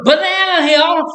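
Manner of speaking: sarcastically sweet hello to get noticed; hammed-up falsetto hello; mock sarcastic greeting. Mock greeting to flirting cashier.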